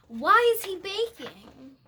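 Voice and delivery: loud, high, surprised wondering. Real, not acted. Reader wonders at the picture.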